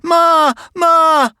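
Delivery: high, loud call for mother; boy screaming for his mother. Acted. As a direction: yell for Ma at full voice. Child calling for mother.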